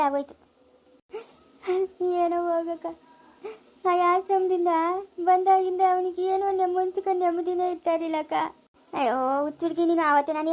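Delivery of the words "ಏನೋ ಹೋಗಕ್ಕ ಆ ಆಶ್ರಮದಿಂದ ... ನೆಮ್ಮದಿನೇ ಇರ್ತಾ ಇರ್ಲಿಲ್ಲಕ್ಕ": tearful lament, words drawn into a wail; bereaved mother's helpless weeping; crying. Acted. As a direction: pour out your grief through tears. Bereaved mother laments through tears.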